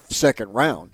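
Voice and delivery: ordinary talk, nothing marked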